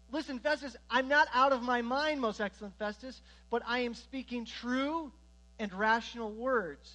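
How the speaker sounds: bold defiant Paul. Acted. Paul on trial answering Festus, loud and bold.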